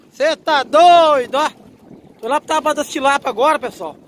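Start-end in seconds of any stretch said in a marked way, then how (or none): 0.1-1.5 loud, slow, stretched cry of disbelief; amazed exclamation. Real, not acted. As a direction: shout it out, amazed at the rough sea. Amazed at the sea and wind. (grito espantado e arrastado)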